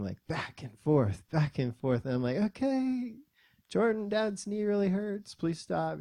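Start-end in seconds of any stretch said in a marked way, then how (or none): 2.4-6.0 high, pleading dad voice; strained, trying to reason with a little kid; strained patience. Retold or imitated. Dad asking his son to stop hurting him, strained patience.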